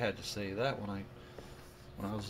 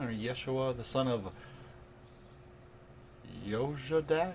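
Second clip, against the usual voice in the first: usual speaking voice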